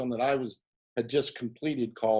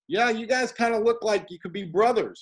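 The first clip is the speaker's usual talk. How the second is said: lightly voiced, pitched-up casual director; a hint of a breezy Hollywood director; casual casting guy; 8 semitones higher, 4 dB louder than usual. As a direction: toss it off like an amused filmmaker. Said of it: "And he goes".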